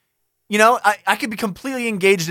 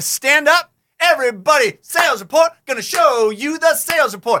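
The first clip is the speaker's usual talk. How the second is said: hype presenter; 7 semitones higher than usual. Pretend. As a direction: pump up the room like a corny host. Mock hype presenter continues.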